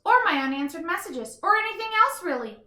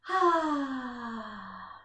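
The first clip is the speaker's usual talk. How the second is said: contented sigh. Acted. Content on couch.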